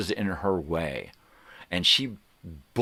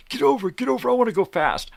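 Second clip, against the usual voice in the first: high, loud impatient demand; mock pushy driver; 6 dB louder, faster than usual. Imitating a tailgater honking to pass, loud and impatient. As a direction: whine like a tailgater.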